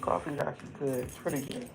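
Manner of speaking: hushed whisper over the coffee; a private whisper to yourself. Real, not acted. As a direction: breathe it, barely voiced. Savoring coffee, talking to self.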